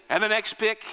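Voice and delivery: ordinary talk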